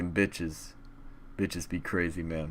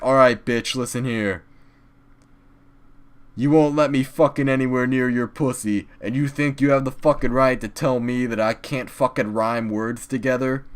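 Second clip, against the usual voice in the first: pretend angry rant; 4 semitones higher, 6 dB louder than usual. Imagined rant at girlfriend.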